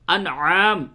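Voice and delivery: انعام with a clear throaty ain at the start; ain pronounced. Shown as a demonstration. Teacher stresses the ain of انعام to students. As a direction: open انعام on a firm ain, not a plain alif. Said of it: "عین کے ساتھ ہے".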